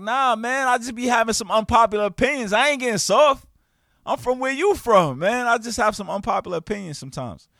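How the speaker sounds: high and loud, pushing back hard; loud retorted defense. Retold or imitated. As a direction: shout down the accusation. Defending himself against being soft.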